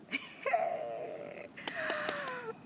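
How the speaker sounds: high, incredulous burst of laughter; disbelieving laugh. Real, not acted. Vlogger reacting to "it was God's will", a disbelieving laugh. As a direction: laugh at how absurd it sounds. Mocking the phrase.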